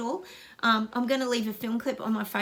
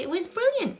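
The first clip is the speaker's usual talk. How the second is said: excited praise; 9 semitones higher, 3 dB louder than usual. Real, not acted. Repeats brilliant with rising excitement.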